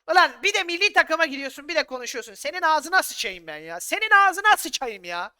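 loud, high furious cursing; furious outburst. Real, not acted. Manager furiously curses the player who left for national duty. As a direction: blow up at the player.

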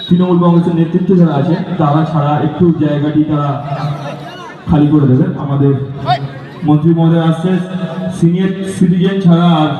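echoing festival loudspeaker. Real, not acted. Stage announcement over loudspeaker.

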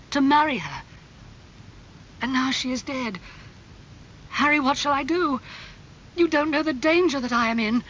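high-pitched, growing agitation; distraught confession. Acted. Dorian begs Harry for advice, increasingly distraught. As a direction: build from troubled recollection to desperate plea.

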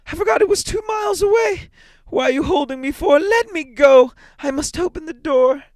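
high, loud, desperate shrieking; frantic cry. A narrator's reading. Mother struggling in her husband's grip, frantic cry. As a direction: scream to be released. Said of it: "she cried, struggling mechanically".

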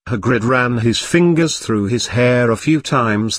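ordinary delivery

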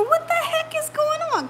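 very high, incredulous mock question; baffled. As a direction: squeak out your bewilderment. Acting out her own puzzlement.

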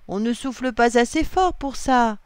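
high, loud protest; exclaimed objection. A narrator's reading. William rejects Noah's blowing idea, exclaimed objection. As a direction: burst out objecting. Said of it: "s'exclave William".

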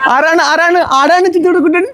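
very high, sing-song cooing. Real, not acted. Uncle meeting his cute little nephew, gushing baby talk.

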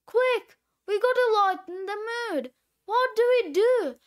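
put-on quick, high, urgent kid; urgent panic. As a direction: rush it out in a mild flap. Kids rush to stop Daddy crying.

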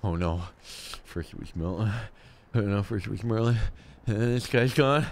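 rising dread. Acted. Survivor afraid he is next, dread building to a yell. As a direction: start very low, climb steadily into a panicked cry. Fears being next to vanish.

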